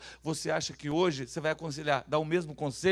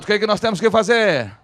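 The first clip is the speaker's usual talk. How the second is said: belted question to the crowd; very loud question; 4 semitones higher, 14 dB louder than usual. Shown as a demonstration. Preacher presses the congregation to repeat it, very loud question. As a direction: push the question out harder, at high volume. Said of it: "Fala bem alto".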